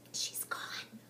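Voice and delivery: soft unvoiced hush; quiet her down; whispered. Molly whispering to hush Heather. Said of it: "I whispered".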